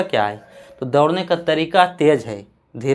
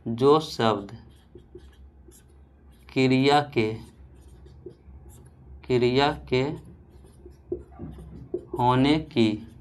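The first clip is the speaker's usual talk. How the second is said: softer, slow, word by word; slow soft dictation; 2 semitones lower, 4 dB quieter, slower than usual. Shown as a demonstration. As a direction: dictate the definition slowly. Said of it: "लिखिएगा". (धीमे स्वर में धीरे-धीरे लिखवाना)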